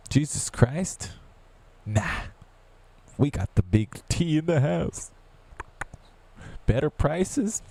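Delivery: mock the Trump fans with scorn; sarcastic mock voice. Sarcastic high voice mocking Trump supporters. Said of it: "said by myself".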